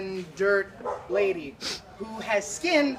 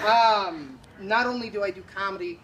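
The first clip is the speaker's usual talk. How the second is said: ordinary delivery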